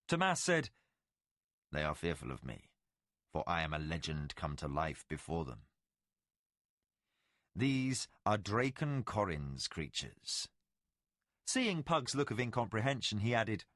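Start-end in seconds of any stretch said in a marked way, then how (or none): 1.7-10.5 much lower, softer, solemn voice; ancient Valheru lord. Acted. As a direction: speak as a legend come to life. Tomas speaks as Valheru.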